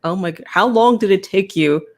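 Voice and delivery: usual speaking voice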